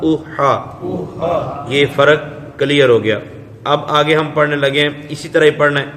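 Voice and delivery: ordinary talk, nothing marked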